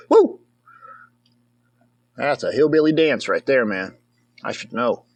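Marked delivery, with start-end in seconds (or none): 0.1-0.4 cheer. Real, not acted. Cheering on the dance.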